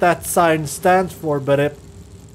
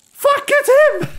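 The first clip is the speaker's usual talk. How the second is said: very high panicked shriek; startled scream; 4 dB louder, slower than usual. Real, not acted. Player sees the old man behind him and shrieks.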